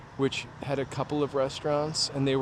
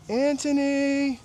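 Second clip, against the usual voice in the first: mother calling Anthony; 12 semitones higher, slower than usual. Retold or imitated. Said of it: "Prince Spaghetti Day".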